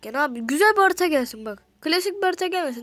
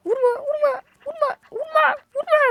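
pleading; 12 semitones higher, 4 dB louder than usual. Real, not acted. Ball hits players, pleading.

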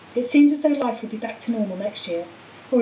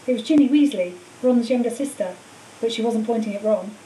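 ordinary delivery, nothing marked

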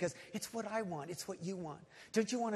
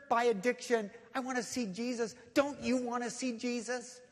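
impassioned preaching; 4 semitones higher, 5 dB louder than usual. Real, not acted. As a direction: build louder and higher into a rallying sermon. Preaching builds to full force.

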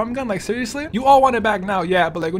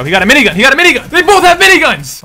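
rising panic, getting louder; panicked warning; 7 dB louder, much faster than usual. Real, not acted. Warning his teammate in a fight, rising panic. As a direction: call out the threat in growing alarm.

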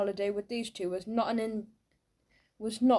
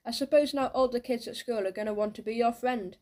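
usual speaking voice

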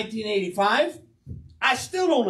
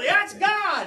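mock Pentecostal shout; 9 semitones higher than usual. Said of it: "been shouting as soon as she said".

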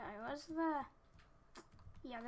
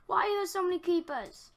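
loud, higher outburst; real exasperated yell; 7 semitones higher, 12 dB louder, faster than usual. Real, not acted. Player swarmed by creepers, exasperated yell. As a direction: yell at the game.

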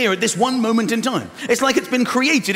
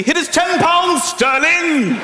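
cocky slow demand; 8 semitones higher, 8 dB louder, slower than usual. Retold or imitated. Acting out paying at till.

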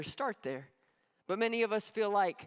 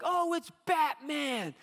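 higher and slow, relieved crowd cry; mock excited cheer; 7 semitones higher, 3 dB louder, slower than usual. Movie crowd cheering as Batman shows up. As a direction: hail the hero arriving. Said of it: "they're like".

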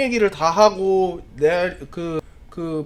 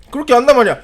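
plain reading voice

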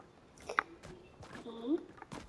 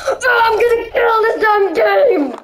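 higher, half-crying angry gamer shout; mock rage; 7 semitones higher than usual. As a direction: rage at the game like a sore loser. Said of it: "Boys be like when they die in Fortnite".

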